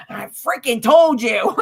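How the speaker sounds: loud, slow and vindicated; retold told-you-so. Wife to her biker husband, vindicated outburst. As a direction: crow it at him, breaking into laughter.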